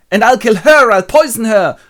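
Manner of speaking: high, loud murderous rant; enraged. Acted. Husband vows to kill his wife, mock fury. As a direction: shout it in hammed-up fury.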